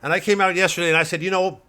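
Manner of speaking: loud, high, ranting right-wing pundit; blustering pundit. Acted. Satirical pundit ranting to his audience, blustering loud. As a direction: bluster like a cable news blowhard.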